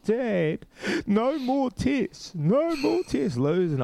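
put-on high, slow child's wail; mock the kids pleading, drawn out and high; whiny kids. Dad imitating his kids begging against tests, high whine. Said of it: "And they're like".

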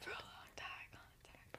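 very faint, slow whisper; whispered echo. Retold or imitated. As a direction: let the refrain fade to a breath. Echoing the song, fading out.

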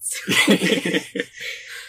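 airy burst of laughter; breathy laugh. Real, not acted. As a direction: laugh out, unvoiced and high. Host laughs at joke.

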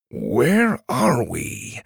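very slow, laboured creature voice; pained wincing. Acted. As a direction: drag the words out through pain. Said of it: "still wincing painfully".

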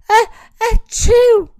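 high, loud mock sneeze; sneezing. A narrator's reading. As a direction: build up and burst into a big achoo. Victor sneezes again.

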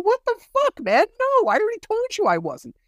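very high-pitched, incredulous protest; retold exasperated outburst. Retelling a denial to the lawyer, exasperated high outburst. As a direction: squeal it in outraged disbelief.

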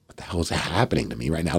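very low, hushed aside to himself; stunned inner thought. Retold or imitated. Extra amazed his idea was liked, hushed disbelief. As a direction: think it aloud under your breath.

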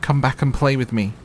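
little rabbit calling after his lost friends; calling after them. A narrator's reading. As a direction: call out after the vanished rabbits. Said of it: "called the little rabbit".

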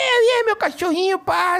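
put-on high cooing baby talk to a dog. Shown as a demonstration. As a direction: coo it like talking to a puppy. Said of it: "eu falo com voz de fofinha com o cachorro".

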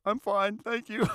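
high, tiny squeak of thanks; squeaky little voice. Retold or imitated. Carried off by a giant soldier, thanking him in a tiny high voice. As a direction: squeak it out like a small kid.

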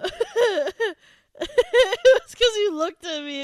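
high, loud, slowed words through laughing; helpless laughter. Real, not acted. Co-host blaming friend's look, laughing helplessly. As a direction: accuse your friend while cracking up. Cracking up after the outburst.

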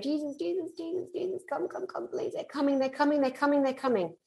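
hushed, high, pleading prayer; frightened praying. Retold or imitated. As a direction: beg in a terrified hush. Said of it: "I'm praying".

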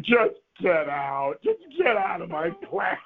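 slow, drawn-out mock dismissal; exasperated teacher. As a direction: stretch each word while ordering the student out. Teacher throwing the student out.

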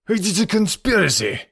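high-pitched, outraged character voice; angry cry. A narrator's reading. Accused Count protests to the room, angry cry. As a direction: cry out in anger, pitched well up. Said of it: "cried Decoud angrily".

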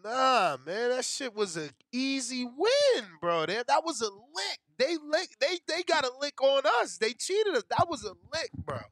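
loud, high, heated complaining; angry rant. Real, not acted. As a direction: vent loud about being robbed. Ranting about being cheated.

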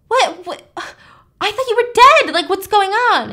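high, loud mock shock; shocked disbelief. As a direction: shriek out startled relief. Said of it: "she's like".

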